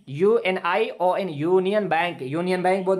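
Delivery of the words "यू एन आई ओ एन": letters यू एन आई ओ एन said slowly one by one; spelling out slowly. Shown as a demonstration. Teacher spelling the word slowly for the class. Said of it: "देख लीजिए union".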